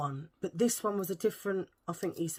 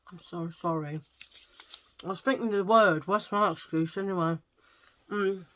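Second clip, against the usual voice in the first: ordinary delivery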